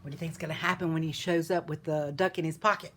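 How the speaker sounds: ordinary delivery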